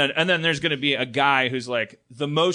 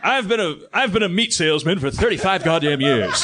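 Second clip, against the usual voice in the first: loud gruff veteran grumble; louder, gruff butcher boasting his years; Keith David impression; 4 semitones higher, 5 dB louder than usual. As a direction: growl it like a weary tough butcher. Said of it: "This is my Keith David impression".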